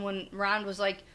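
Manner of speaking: ordinary delivery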